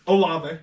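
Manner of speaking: "Olave" in three syllables, oh-LAH-vay; correcting pronunciation. Shown as a demonstration. Host correcting a friend's name reading, "Olave" as oh-LAH-vay. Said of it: "So you were close".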